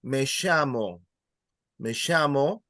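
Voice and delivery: "llamo" with ll as "sh"; "llamo" said "shamo"; Argentine "llamo". Shown as a demonstration. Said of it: "Three ways of pronouncing that".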